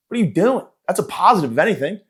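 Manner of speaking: ordinary talk, nothing marked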